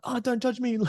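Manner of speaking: very high mock-defensive squeal; stressed-out workaholic. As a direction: play it as a hammed-up touchy worker. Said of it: "I'm so stressed out".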